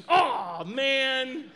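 loud groan, laughing; mock dismay. Pastor reacting to God's answer with a loud laughing groan. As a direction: groan loudly, then laugh.

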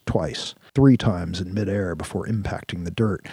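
ordinary delivery

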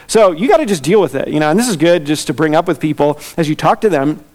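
normal talking voice

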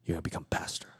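soft whispered calling; God whispering his call to the young man; God's whisper. Retold or imitated. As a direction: breathe it like a voice from heaven. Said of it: "God was like".

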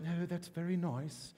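soft, prim British aside; mock British reserve. Preacher mocking the subdued British coronation, soft and prim. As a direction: mimic a polite, unmoved British onlooker. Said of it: "it's not the British".

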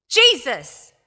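sudden loud call of the name; loud cry. Acted. Possessed man at Jesus' feet, loud cry. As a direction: punch out the name loudly, then let it fall.

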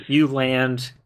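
ordinary delivery

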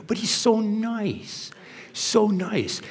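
high, drawn-out, syrupy praise; mock gushing. People excusing a wrongdoer, mock gushing. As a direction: stretch "nice" in an imitated coo. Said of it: "Haven't you heard people describe it?".

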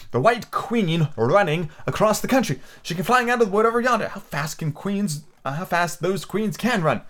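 put-on high-pitched wondering girl character; excited Alice. A narrator's reading. Alice amazed at how fast the queen runs, excited high voice. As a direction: marvel at it in a high voice.

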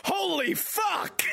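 very high, drawn-out mock yell; imitated shout. One dude in the theater seeing Shadow, imitated amazed shout. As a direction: imitate a stunned moviegoer crying out. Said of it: "I just hear one dude go".

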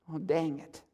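quiet, low, rueful; dismayed low mutter. Retold or imitated. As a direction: mutter it under your breath. Recurring dismayed refrain.

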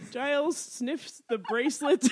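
slow, breaking into real laughter; cracking up. Real, not acted. Recapper reaching the hilarious bit, cracking up. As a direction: draw it out, losing it to laughter. Reads funny plot moment, cracking up.

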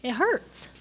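ordinary talk

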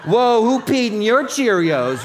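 loud, high sarcastic jab; mock snark. Pastor mocking a snarky retort to the congregation, loud and high. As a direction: toss it off with cheeky sass.

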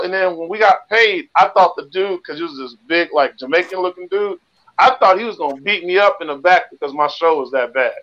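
normal talking voice

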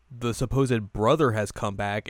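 ordinary delivery

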